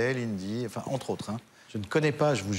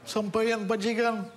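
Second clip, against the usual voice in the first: higher, lightly voiced tough guy; a hint of a movie tough guy, higher; De Niro voice; 8 semitones higher than usual. Shown as a demonstration. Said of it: "faites De Niro, là".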